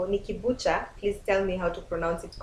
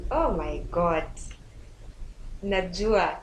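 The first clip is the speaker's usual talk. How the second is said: usual speaking voice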